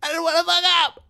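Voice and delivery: very high, squealed mock sobbing; hammed-up tearful high whine; crying. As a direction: wail it like a man breaking down. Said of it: "He starts crying up there".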